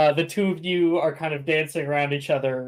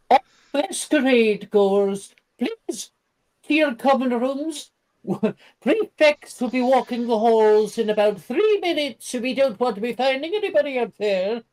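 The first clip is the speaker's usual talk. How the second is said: high, loud PA announcement voice; over the intercom; 9 semitones higher, 4 dB louder than usual. Acted. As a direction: announce it over the school speakers. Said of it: "you can hear the intercom go off".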